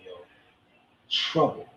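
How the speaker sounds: usual speaking voice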